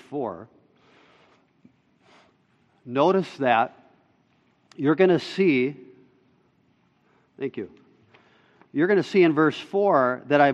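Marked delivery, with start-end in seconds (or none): none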